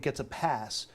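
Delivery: usual speaking voice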